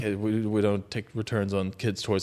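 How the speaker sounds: low, quiet, offhand shop clerk brush-off; bored shopkeeper. Retold or imitated. Shopkeeper brushing off the panicked customer, low and quiet. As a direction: shrug it off like a tired shopkeeper. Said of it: "And the guy's like".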